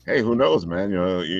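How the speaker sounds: usual speaking voice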